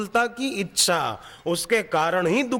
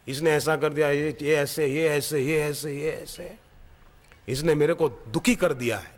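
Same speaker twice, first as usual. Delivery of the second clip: usual speaking voice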